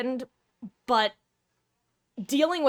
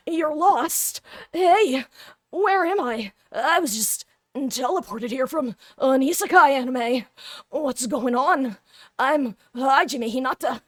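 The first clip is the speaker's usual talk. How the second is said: higher, brighter hero voice; anime protagonist voice; 5 semitones higher, 4 dB louder than usual. Acted. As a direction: play a confused anime hero. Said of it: "this is my generic anime protag voice".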